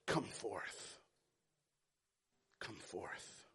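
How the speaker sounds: low, soft, solemn command; Jesus calling gently at the grave; hushed call. Acted. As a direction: whisper it with weight. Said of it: "he calls out in a loud voice".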